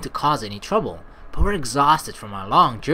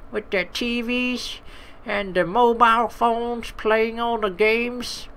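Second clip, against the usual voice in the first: put-on higher, complaining old lady; fed-up old woman; 6 semitones higher, slower than usual. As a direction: rant about those kids. GM voices the old innkeeper.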